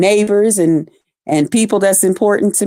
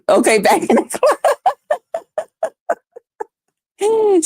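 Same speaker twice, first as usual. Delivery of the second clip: laughing read; 13 semitones higher, faster than usual. Real, not acted. Reads comment laughing.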